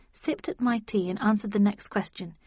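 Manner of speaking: usual speaking voice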